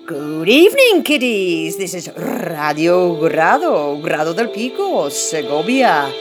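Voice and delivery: hammy spooky radio host; vintage horror narrator. Acted. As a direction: greet the kids like a creepy horror host.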